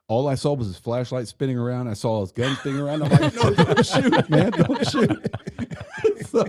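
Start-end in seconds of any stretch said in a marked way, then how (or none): 3.4-5.1 very high retold yelp, laughing; mock panicked plea. Retelling his plea to the spinning partner, laughing mock panic. 5.2-6.2 very high giggle; laughing. Real, not acted. Cracking up at his own prank story, high laugh.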